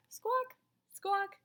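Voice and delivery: high squawking penguin calls; two penguins calling back to their egg, squawking. A narrator's reading. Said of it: "Roy and Silo called back".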